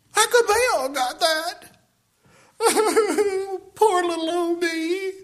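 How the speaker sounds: whiny pouting, slow, with a laugh; mock self-pity. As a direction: pout like a sulking child. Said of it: "my lip all stuck out".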